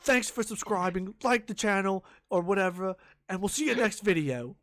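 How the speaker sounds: loud, high YouTuber sign-off; Ali A. Retold or imitated. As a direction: wrap up with overblown streamer cheer. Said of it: "that's exactly how it is".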